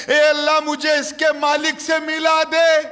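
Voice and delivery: loud, high pleading cry to God; desperate prayer. Acted. Young man begging God to lead him to the owner, loud pleading cry. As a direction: beg God at full voice.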